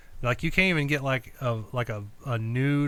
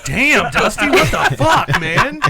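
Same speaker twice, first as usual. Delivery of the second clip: high, loud, laughing disbelief; laughing shout; 7 semitones higher, 8 dB louder, slower than usual. Retold or imitated. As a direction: blurt it out through laughter. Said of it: "the first thing I thought was".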